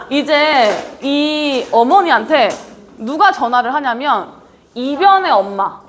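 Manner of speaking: normal talking voice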